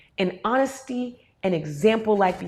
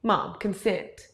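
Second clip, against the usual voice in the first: slow deadpan reminder; 2 semitones lower, 5 dB quieter, much slower than usual. Retold or imitated. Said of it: "Now she'll say".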